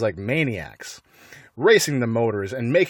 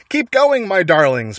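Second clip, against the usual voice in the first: out of breath, urging; panting; 7 semitones higher, 6 dB louder than usual. A narrator's reading. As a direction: puff it out breathlessly. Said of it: "panted Mister Fox".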